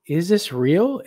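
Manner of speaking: mock disbelief, lifted in pitch; questioning disbelief. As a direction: voice someone doubting what they see. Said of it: "you're questioning".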